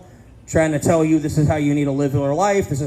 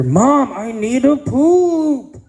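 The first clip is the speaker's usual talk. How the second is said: put-on higher, louder, drawn-out kid whine; desperate whiny kid; 7 semitones higher, 5 dB louder, much slower than usual. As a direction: stretch it out like a little boy who can't hold it. Kid asks his mom again.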